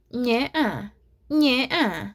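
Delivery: high, loud mock Russian accent; attempted Russian accent. Shown as a demonstration. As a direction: test a Russian accent on one word. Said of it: "let me try to do a Russian accent".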